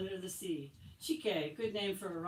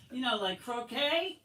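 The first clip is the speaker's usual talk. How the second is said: cheeky joke; 6 semitones higher, 6 dB louder than usual. Acted. Boy joking in class.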